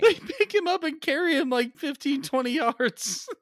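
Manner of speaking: high-pitched, laughing disbelief; incredulous laughing. Real, not acted. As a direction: crack up while saying it, voice shooting up. Cracking up while retelling the scene.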